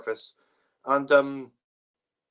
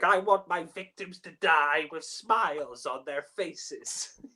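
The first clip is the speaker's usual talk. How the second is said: higher, slow mock-villain voice; Candyman; 5 semitones higher, slower than usual. Mocking the Candyman's bad lines, hammed-up slow villain. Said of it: "giving some really bad lines, like".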